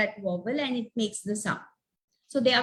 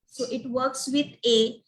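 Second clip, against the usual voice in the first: ordinary delivery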